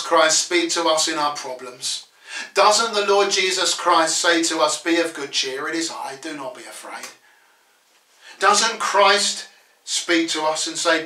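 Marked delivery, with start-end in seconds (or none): 4.8-7.1 low, quick, understated comfort; quiet reassurance. Acted. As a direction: reassure them in a lowered voice. Same words applied gently to listeners.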